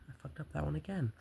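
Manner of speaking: ordinary delivery, nothing marked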